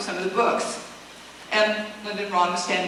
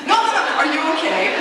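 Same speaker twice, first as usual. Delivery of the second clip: higher, louder anxious repeated no; urgent, insisting she's hurt; worried insistence; 8 semitones higher, 6 dB louder than usual. Retold or imitated. Castmates checking she's on fire, alarmed. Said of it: "Schwartz and everybody else going".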